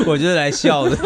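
laughing reply. Real, not acted. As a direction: answer through laughter. Co-host laughs back.

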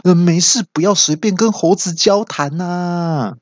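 high, stretched-out punchline; hammed-up reply with a long drawn ending; put-upon dad. Dad brushing off his worried son, put-upon. Father answers son in joke.

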